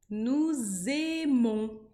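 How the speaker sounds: nous aimons said very slowly with an open e in aimons; French nous aimons. Shown as a demonstration. Teacher modeling nous aimons with the E sound. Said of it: "A mais I vai ter som de E".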